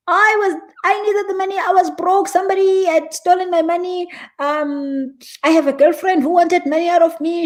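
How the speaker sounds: hammed-up victim complaining about being robbed; whiny victim voice. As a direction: play the wronged victim, protesting your innocence. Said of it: "Yeah, I'm a victim here".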